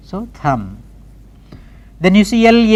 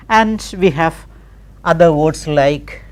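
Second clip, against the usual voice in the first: ordinary delivery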